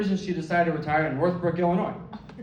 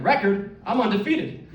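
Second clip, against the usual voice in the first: higher, louder champion's boast; boastful grandma; 6 semitones higher, 3 dB louder than usual. Retold or imitated. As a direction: scoff then boast proudly.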